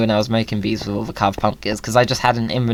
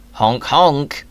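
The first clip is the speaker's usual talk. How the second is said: goose honk; 4 semitones higher than usual. Pretend. Imitating a goose.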